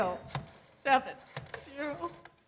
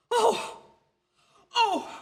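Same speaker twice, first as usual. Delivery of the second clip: startled; 7 semitones higher, 6 dB louder than usual. Acted. She discovers her clock stopped.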